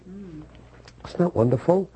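an awed, quiet echo; hushed wonder. Real, not acted. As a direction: drop to a hushed marvel.

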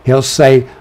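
ordinary talk

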